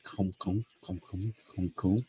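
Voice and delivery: soft, low, steady pulse sounds; heartbeat imitation. Shown as a demonstration. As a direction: tap out a heartbeat softly with the voice. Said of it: "That is a heartbeat".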